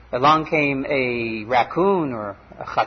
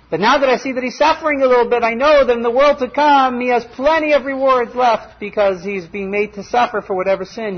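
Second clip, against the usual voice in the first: joyful explanation; 7 semitones higher, 4 dB louder than usual. Acted. Akiva explains his joy.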